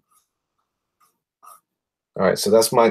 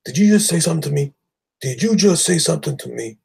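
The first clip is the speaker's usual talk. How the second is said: higher, louder, fast indignant challenge; character caught off guard, mock angry; surprised tough guy; 8 semitones higher, 6 dB louder, faster than usual. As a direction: bristle at being spoken to. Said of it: "He looks really surprised".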